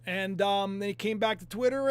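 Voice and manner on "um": usual speaking voice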